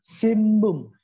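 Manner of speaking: "sinbung" said as simbung, with a held m; read with m. Shown as a demonstration. Teacher shows n before b, "sinbung" sounded as simbung. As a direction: close the lips on the n of "sinbung". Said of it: "dibaca dengan sinbung".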